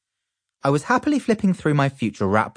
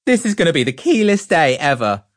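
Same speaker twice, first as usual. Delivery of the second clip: excited boast; 9 semitones higher, 6 dB louder than usual. A narrator's reading. Excited boy.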